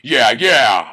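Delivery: ordinary delivery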